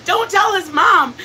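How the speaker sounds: slow, laughing near-shout; laughing plea. Retold or imitated. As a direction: beg through laughter not to tell. Joking quote about nephew's fall.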